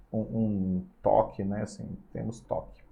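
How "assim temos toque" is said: whispered aside. Real, not acted. Aside while switching screen.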